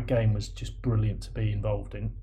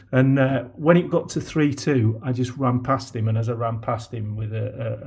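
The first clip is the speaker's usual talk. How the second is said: usual speaking voice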